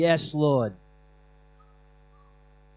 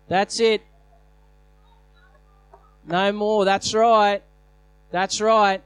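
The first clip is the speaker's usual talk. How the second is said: rally the church with fire; fiery revolutionary speech; 3 semitones higher, 3 dB louder than usual. Acted. Preaching at full force.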